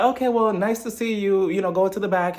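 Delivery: lightly imitated breezy send-off; dismissive host. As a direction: politely send them off, voice lifted. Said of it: "Andy was just like".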